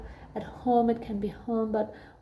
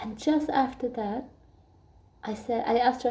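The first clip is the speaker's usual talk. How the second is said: ordinary talk, nothing marked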